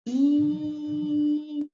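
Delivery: "Item" drawn out high and long; demo word. Shown as a demonstration. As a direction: stretch "Item" slowly, stressing I. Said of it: "Só o pinguinho, não tem acento". ("Item" pronunciado devagar, tônica no I)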